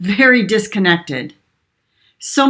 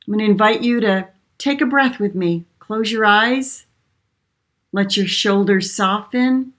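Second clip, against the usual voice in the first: soft spa whisper; 3 dB quieter than usual. Acted. Leads a short breathing exercise.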